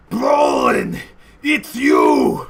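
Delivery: high, loud, overjoyed greeting; joyful shout. Acted. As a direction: cry out the name happily. Uncle Boris greets Brolin.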